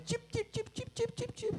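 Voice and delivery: high, soft chirping bird; sparrow chirping. Retold or imitated. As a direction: peep it like a tiny sparrow. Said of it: "the little bit of sparrow out there".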